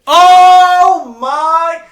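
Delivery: high, loud burst of surprise; surprised. Real, not acted. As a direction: shriek it out in shock. Reacts to the movie pick.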